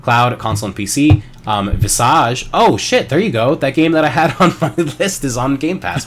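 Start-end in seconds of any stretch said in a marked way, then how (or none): 2.5-6.1 excited. Real, not acted. Finds wanted game on list.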